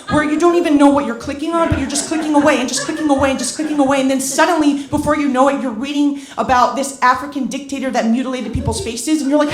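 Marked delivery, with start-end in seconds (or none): none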